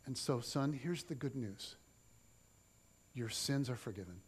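soft, low and tender blessing; gentle Jesus. Acted. Jesus softly reassuring the paralyzed man. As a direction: bend down and tell him gently.

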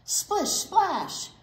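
bouncy storytime rhyme reading; rhyming read-aloud. Acted. Librarian reading a rhyme to children, bouncy. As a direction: read the rhyme out to the little ones.

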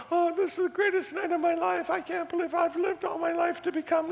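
high, hammed-up tearful gushing; mock gushing graduates. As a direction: parody the weepy new reverends in a shrill cry. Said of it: "they're crying about".

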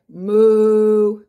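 long, loud cow's moo. A narrator's reading. Storyteller voicing the cow, long loud mooing. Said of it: "Cow said".

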